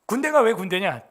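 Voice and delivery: ordinary talk, nothing marked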